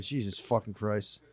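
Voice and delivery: low, quiet, worn-out curse; weary mutter. Retold or imitated. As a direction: groan it under your breath. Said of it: "And I was like".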